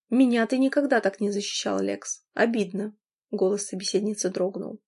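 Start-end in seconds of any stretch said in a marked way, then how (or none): none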